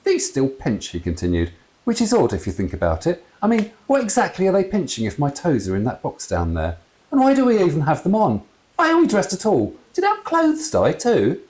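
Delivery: rising bewildered dad; puzzled ghost father. A narrator's reading.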